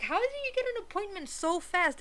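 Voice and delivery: retold impressed disbelief. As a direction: ask it in mock amazement. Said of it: "like, impressed".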